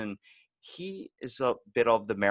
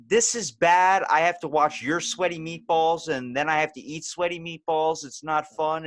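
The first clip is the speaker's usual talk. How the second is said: loud, higher-pitched mock complaint; exasperated; 5 semitones higher, 5 dB louder than usual. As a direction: protest it loudly, pitched up. Retelling exasperated reaction to bad improv.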